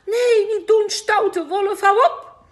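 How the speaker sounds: very high, loud panicked cry; frightened protest. A narrator's reading. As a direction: beg the wolf to stop in fright. Grandma attacked by wolf.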